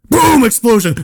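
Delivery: high, loud boom; dramatic outburst. Acted. Storyteller springs the scare on the table, high loud outburst. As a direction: burst out with a sudden bang. Narrator acts sudden door burst.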